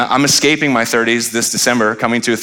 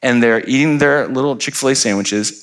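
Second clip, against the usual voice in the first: ordinary delivery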